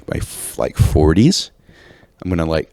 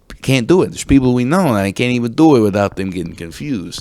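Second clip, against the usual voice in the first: put-on Italian-American New York accent, pitched up; accento italoamericano di New York; 5 semitones higher than usual. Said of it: "like you're an Italian from New York".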